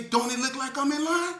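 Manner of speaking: loud, high, irritated comeback; annoyed retort. Retold or imitated. Speaker retorting to the woman behind him, loud and irritated. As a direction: snap back at her, loud. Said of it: "I say".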